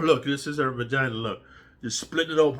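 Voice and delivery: ordinary delivery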